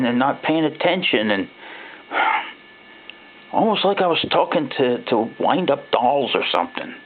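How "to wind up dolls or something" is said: ordinary delivery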